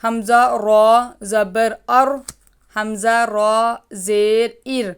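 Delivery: ار with the ر said full and heavy; teacher sounding ار heavy and full; full ر drill. Shown as a demonstration. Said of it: "یہ پر پڑھا جائے گا".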